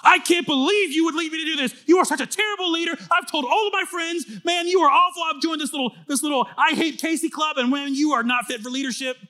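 hammed-up angry member ranting at his pastor, rising to a shout; angry church member. Pastor imitating a church member angrily telling off their leader. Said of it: "and you're like".

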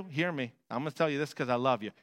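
ordinary talk

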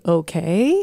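okay with a rising question tune. Shown as a demonstration. Parent showing what not to add, rising okay. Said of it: "I don't have to say".

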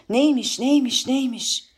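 high-pitched, repeated demand; clamouring questions. Acted. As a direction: voices of a crowd demanding to know. Voices clamour about the document.

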